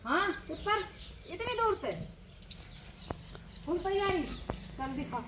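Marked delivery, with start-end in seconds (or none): none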